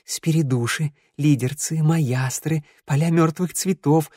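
creeping, ominous list of horrors; fearful warning. Acted. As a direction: scare them with every monster. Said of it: "затрясся от страха".

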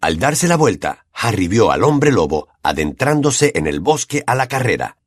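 usual speaking voice

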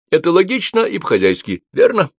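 high, loud, jovial assertion; emphatic, chummy confidence; hearty appeal for agreement. A narrator's reading. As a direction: push for a yes, voice jumping up on the last word. Hearty character seeks agreement.

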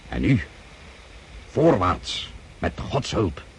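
battle cry; rallying charge cry. Acted. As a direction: rouse the men into the attack. Commander leads cavalry charge.

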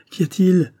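anxious mother calling out; anxious cry. A narrator's reading. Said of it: "Angoissée".

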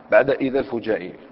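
usual speaking voice

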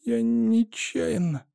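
slow, high, weak little voice; guilty child. A narrator's reading. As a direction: confess it faintly, ashamed. Sick child confessing weakly.

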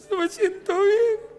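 high, tearful mock sob, said slowly; imitating a crying man. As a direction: play the weeping man, voice cracking high. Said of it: "y empieza a llorar". (imitando a un hombre que llora, voz aguda y quebrada)

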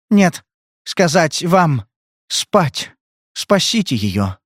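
slow, halting plea that sinks at the end; drugged girl. A narrator's reading. Drugged girl begging Spade to save Bridget, halting. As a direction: force the words out, then fade.

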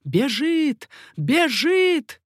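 high, loud shouted alarm; shouting. A narrator's reading. Sentries shout that the rider is fleeing, loud alarm. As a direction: shout the alarm, rising on the repeat. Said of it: "и крикнули".

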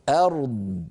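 ordinary talk, nothing marked

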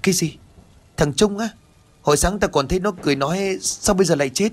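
a lightly acted gasp of disbelief; shocked disbelief. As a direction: neighbour woman hearing the dead boy's name, lightly shocked. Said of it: "trợn mắt hỏi".

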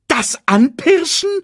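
high, loud incredulous echo; alarmed disbelief. Acted. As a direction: repeat it slowly, aghast. Sasu alarmed at being stalked.

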